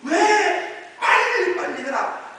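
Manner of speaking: loud, high angry command; worked-up shout for haste; angry urging. Acted. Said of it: "화를 내면서".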